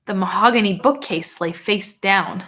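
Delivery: usual speaking voice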